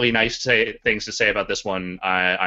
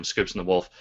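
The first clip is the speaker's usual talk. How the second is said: ordinary delivery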